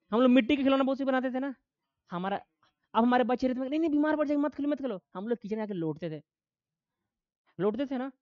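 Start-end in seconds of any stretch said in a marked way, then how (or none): 3.7-5.0 higher-pitched mock parental fretting; imitated parental warning. Voicing parents forbidding children's play. (बच्चों को टोकते माता-पिता की नकल)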